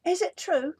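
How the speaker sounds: butter him up with wide-eyed wonder; flattering princess. A narrator's reading. Princess flattering the dragon, high and sweet.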